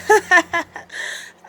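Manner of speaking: high mocking laugh; wolf laughing. Wolf laughs at the shopkeepers, mocking laugh. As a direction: cackle at the fooled shopkeepers. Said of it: "The young wolf just laughed".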